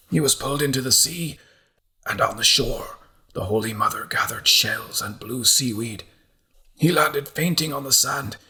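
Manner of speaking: a mad monk reciting his vision, rapt; entranced visionary. Acted. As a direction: chant it like a holy fever dream. Derek recounts his vision.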